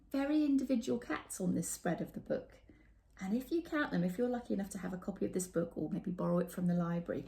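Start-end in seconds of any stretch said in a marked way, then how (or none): none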